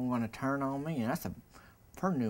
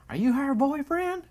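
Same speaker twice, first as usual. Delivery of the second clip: put-on much higher and louder, a befuddled old man; confused old man; 7 dB louder than usual. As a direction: demand it high and loud like a confused old man. Senile husband questions the mower.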